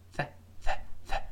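voiceless "th", tongue tip against upper teeth; short airy "th", repeated; isolated TH sound. Shown as a demonstration. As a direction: push out bare "th" sounds with no voice. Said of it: "舌尖吐出来一点儿".

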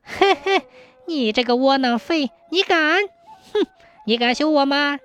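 high, taunting wife's voice; gloating scolding. A narrator's reading. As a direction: jeer and dare him with a mocking chuckle. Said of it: "妻子幸灾乐祸地指着卫东骂".

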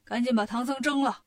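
loud, urgent barked orders; shouting demon king. Acted. Demon king orders his minions to cook the monk, loud hurried shouting. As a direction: yell for the pot in a greedy hurry. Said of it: "进洞就喊".

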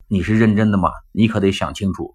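normal talking voice

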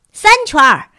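loud, high shout. A narrator's reading. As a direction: call it out to the whole crowd. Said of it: "克里斯托芬·塔尔波特喊道".

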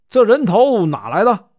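loud, angry question from the bench; furious demand. A narrator's reading. The prefect to the accused, outraged at the head: loud angry demand. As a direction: bark it out in sudden rage. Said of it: "勃然大怒".

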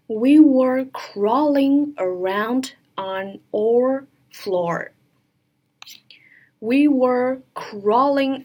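very slow, drawn-out teacher's model; sentence-reading demo. Shown as a demonstration. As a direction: read it slowly, word by word, for learners. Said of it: "我们来看一下整个句子该怎么读". (慢速逐词示范朗读整句)

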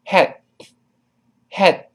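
"had" with the final d held back, breath stopped; unreleased d. Shown as a demonstration. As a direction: stop the tongue on the d of "had" without releasing it. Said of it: "而憋气". (“had”结尾的d只做动作、憋气不送出)

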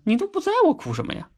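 high, lightly voiced whiny kid; mock child voice. Retelling the joke, mother hears the child's high mock voice. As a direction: play the little child asking why cry. Child quoted in a funny story.

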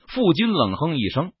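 usual speaking voice

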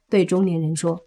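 usual speaking voice

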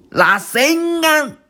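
put-on boy calling out his special move mid-fight; attack cry. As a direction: drag out the move's name as you throw it. Boruto throws his Rasengan.

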